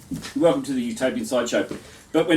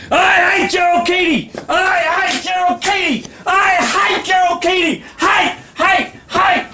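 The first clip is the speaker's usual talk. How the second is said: high, loud chanted jeers; mangled yelling; 8 dB louder, much slower than usual. Acted. Poet voices the crowd yelling hate at the poet, high cacophonous shouting. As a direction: yell it like a cacophonous mob, pitched way up. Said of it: "here's what they yell in mangled, cacophonous strophe".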